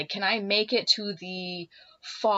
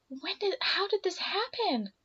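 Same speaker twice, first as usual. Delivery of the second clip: concerned; 13 semitones higher than usual. Retold or imitated. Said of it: "concerned for me".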